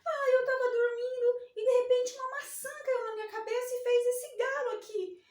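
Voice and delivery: high, whimpering mock cry; little letter A crying to her friend. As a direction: whine about the bump like a hurt child. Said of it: "Aí o A falou assim".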